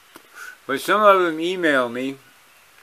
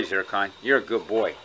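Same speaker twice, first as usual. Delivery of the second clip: normal talking voice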